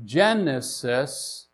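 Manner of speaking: "genesis" slowly with a soft G as in gem; soft G pronunciation. Shown as a demonstration. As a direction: say "genesis" drawn out, starting with a j sound. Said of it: "to a soft G".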